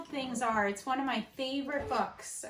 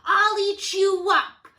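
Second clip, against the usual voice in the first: defiant shout; 3 semitones higher, 9 dB louder than usual. Acted. Max to his mother, loud defiant yell. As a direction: shout it back at mom like a wild thing. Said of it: "and Max said".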